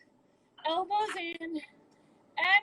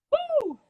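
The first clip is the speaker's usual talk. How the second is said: excited whoop; 11 semitones higher, 5 dB louder than usual. Real, not acted. Coach cheering mid-workout.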